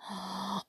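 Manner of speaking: quick breathy gasp; soft frightened gasp. Acted. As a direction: gasp in dismay. Frightened beat.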